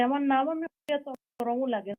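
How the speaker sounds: ordinary talk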